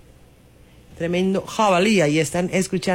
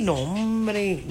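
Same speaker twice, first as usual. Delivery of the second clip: ordinary talk, nothing marked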